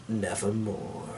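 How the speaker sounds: very soft, low dying nevermore; hushed final word. A narrator's reading. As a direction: let it fade into nothing.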